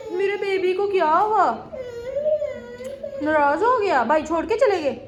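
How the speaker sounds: high, soft coaxing coo; soft high motherly comfort. Real, not acted. Mother comforts crying baby.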